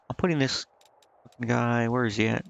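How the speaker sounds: ordinary delivery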